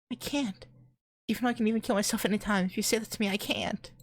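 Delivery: put-on high-pitched, upset girl's voice; ashamed girl. A narrator's reading. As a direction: protest high and hurried, like a distressed girl. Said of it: "She seems ashamed to see me".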